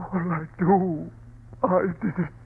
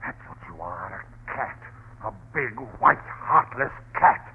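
hissed insult; 11 semitones lower, 5 dB quieter than usual. Acted. Husband taunting wife.

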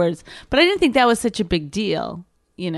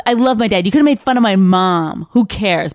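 louder, wounded protest; mock-upset bride, louder; 8 dB louder than usual. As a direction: defend her dad, stung. Bride upset about her dad.

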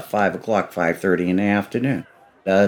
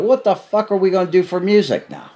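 mild mock yell, pitched up high; retold screaming; 8 semitones higher than usual. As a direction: imitate a woman yelling in complaint. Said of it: "Dolly starts screaming".

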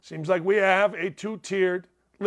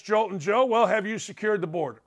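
ordinary talk

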